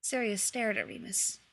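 ordinary delivery, nothing marked

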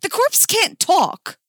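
high, loud, slow objection; indignant and high-pitched; protesting. Acted. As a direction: protest loudly, drawing out the words. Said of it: "Jason protested".

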